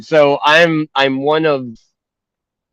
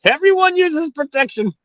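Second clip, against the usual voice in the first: mock mom; 14 semitones higher than usual. Mom voiced, pitched high.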